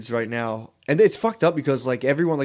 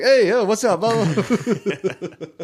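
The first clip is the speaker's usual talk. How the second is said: hammy comedian voice; 8 semitones higher, 4 dB louder, much slower than usual. Pretend. Mock stand-up comic.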